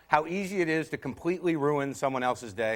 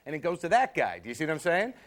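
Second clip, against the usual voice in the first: ordinary talk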